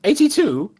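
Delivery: high-pitched little clerk calling out; high, questioning waiting-room call; little bespectacled man. Acted. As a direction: call the number in a high, put-on voice. Doorway man calls out ticket number.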